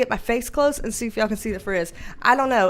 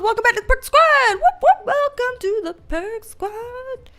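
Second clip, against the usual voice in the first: excited greeting; 11 semitones higher, 4 dB louder than usual. Real, not acted. Greeting chat viewers excitedly.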